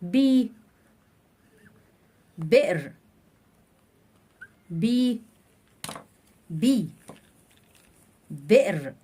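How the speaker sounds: teacher drilling "بي" and "بئر" slowly; syllable drill. Shown as a demonstration. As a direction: sound out "بي" before the word "بئر". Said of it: "كلمة بحركة الكسر".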